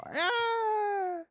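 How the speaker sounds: long, very high sustained "ah"; mock squeal on a held high note; high-pitched squeal. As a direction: squeal one thin note and hold it. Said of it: "and I go".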